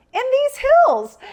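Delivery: very high, loud, drawn-out mimicry; slow, hammed-up high exclamation; exclaimed reply. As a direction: imitate a friend's squealed answer. Friend's laughing reply, acted out.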